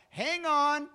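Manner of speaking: high, loud call to hold on. Acted. God calling to his children, loud and high. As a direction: urge them to hold on.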